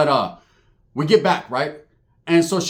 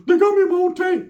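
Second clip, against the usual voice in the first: squeaky high cartoon-mouse cousin; Mickey Mouse voice; 13 semitones higher, 4 dB louder than usual. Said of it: "put the Mickey Mouse to it".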